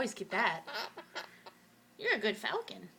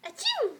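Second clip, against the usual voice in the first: high fake sneeze sound; 9 semitones higher, 6 dB louder than usual.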